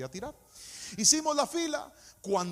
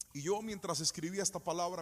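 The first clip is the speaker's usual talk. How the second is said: usual speaking voice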